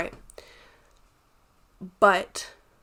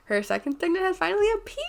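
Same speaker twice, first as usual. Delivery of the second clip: laughing excitedly; 11 semitones higher, faster than usual. Real, not acted. Big reveal she loves.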